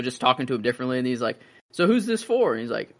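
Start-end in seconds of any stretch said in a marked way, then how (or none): 1.7-2.5 chirpy salesman. Retold or imitated. Casket dealer voiced in retelling.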